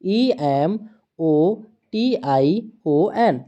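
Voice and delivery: slow, evenly spaced letter-by-letter spelling; teacher dictating letters one at a time. Shown as a demonstration. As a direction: read each letter out slowly for the class. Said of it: "word यहाँ पर हमारा दिया है".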